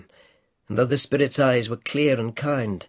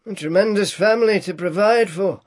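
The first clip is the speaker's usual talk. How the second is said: muttering Scrooge; 7 semitones higher, 5 dB louder than usual. A narrator's reading. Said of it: "muttered Scrooge".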